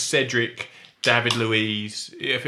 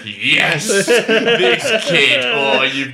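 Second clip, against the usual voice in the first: louder, much higher gushing praise; thrilled mock praise, much louder; mock delight; 7 semitones higher, 8 dB louder than usual. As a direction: gush over a young star. Imitating an excited commentator.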